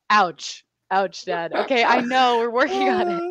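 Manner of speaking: mock hurt. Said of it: "And I'm like".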